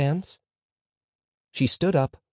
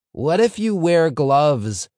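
pupil's question, higher and louder, drawn out; questioning pupil Moshe Tzvi; 6 semitones higher, 6 dB louder, slower than usual. A narrator's reading. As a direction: pipe up slowly with a what-if.

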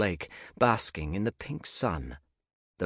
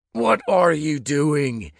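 shouting; 8 semitones higher, 9 dB louder, slower than usual. Acted. Nick bursts in, shouting.

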